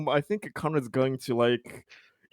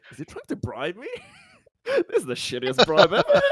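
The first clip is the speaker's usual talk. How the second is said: laughing confused bribe thought; 9 semitones higher, 3 dB louder than usual. Acted.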